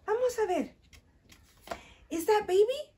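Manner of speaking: usual speaking voice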